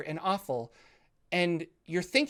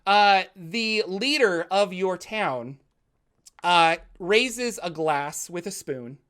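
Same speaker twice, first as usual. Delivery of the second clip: ordinary delivery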